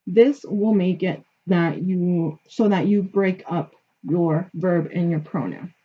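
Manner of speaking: normal talking voice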